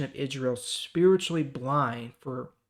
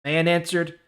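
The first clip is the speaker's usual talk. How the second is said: louder, clear Bible reading voice; scripture reading; 3 semitones higher, 8 dB louder than usual. Acted. YouTuber reading John chapter nine to his viewers, projected scripture reading. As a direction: read the chapter out like a preacher.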